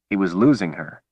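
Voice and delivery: ordinary delivery, nothing marked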